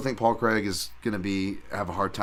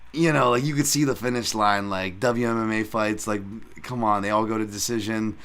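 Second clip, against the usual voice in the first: ordinary talk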